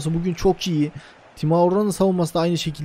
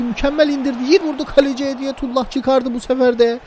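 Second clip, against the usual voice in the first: excited; 6 semitones higher than usual. Real, not acted. Shot on goal, live commentary.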